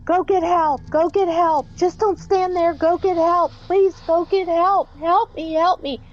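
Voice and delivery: retold frantic scream. Ghostly woman begs couple to fetch help, frantic high plea. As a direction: imitate a terrified woman begging for help.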